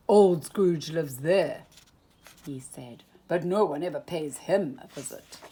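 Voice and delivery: a hint of a folksy cowboy sheriff; lightly voiced sheriff. A narrator's reading. Goofy voiced by narrator.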